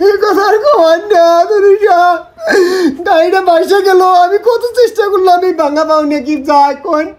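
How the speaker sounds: high-pitched mock crying; hammed-up tearful lament; fake wailing. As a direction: wail and sob for sympathy. Sham grief over lost vehicle.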